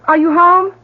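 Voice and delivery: loud high calls through the house; calling out. Acted. Mother searches the house for her son, loud calls. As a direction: call his name from the hall.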